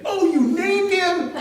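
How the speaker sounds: imitating a high, slow shriek of disbelief; hammed-up shocked squeal; mock outraged surprise. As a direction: draw it out high, as appalled girls would. Retells girls' shocked reaction.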